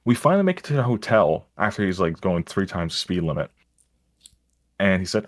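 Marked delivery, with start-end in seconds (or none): none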